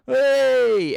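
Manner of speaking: high, loud hey; sudden shout; call out. Acted. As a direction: grab everyone's attention. Calling out.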